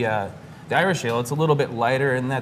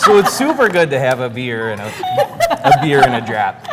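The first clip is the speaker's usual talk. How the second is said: put-on Wisconsin accent; 9 dB louder than usual. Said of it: "a Wisconsin accent".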